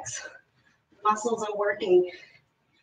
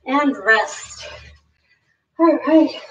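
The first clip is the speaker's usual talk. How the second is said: breathless from the workout; winded coach talk; out of breath; 4 semitones higher than usual. Real, not acted. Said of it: "I'm a bit out of breath here".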